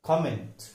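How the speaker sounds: कमेंट as KOM-ent; careful model of कमेंट; correct pronunciation. Shown as a demonstration. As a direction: open rounded "ko" starting कमेंट. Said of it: "इसका सही उच्चारण है".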